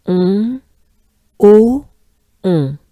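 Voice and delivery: nasal "On", loud open "o", nasal "on"; French nasal on. Shown as a demonstration. Teacher drilling parts of Montbeauvon. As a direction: close the mouth for "on", open for "o". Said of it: "we close up again for the last on".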